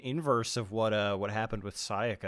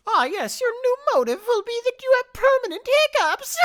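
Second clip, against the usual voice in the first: very high squeaky taunting voice; gleefully cruel mascot; villain voice; 8 dB louder than usual. Pretend. Mocking villain voice.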